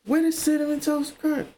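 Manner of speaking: surprised call. Retold or imitated. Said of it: "And I was like".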